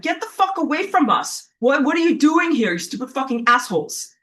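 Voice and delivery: high-pitched indignant outburst, easing down at the end; angry telling-off. Acted. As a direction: tell off intruders in exasperation. Acts out what she wanted to shout.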